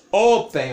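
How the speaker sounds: "open" with a loud O, weaker second syllable; first-syllable stress. Shown as a demonstration. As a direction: hit the O of "open" hard. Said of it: "a sílaba tônica não é a última".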